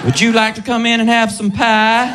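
Southern belle invitation; Georgia peach lilt. Acted. Comic as a Southern woman offering pie, Georgia lilt. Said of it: "it's got that Georgia peach lilt to it".

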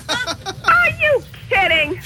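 high, slow, half crying with relief; overwhelmed relieved laugh; laughing disbelief. Real, not acted. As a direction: stretch it out between laugh and tears. Relief after prank revealed.

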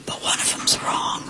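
hushed whisper, imitating him; secretive whispered punchline; whispered aside. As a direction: slip the verdict out in a mock whisper.

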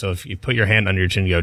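ordinary delivery

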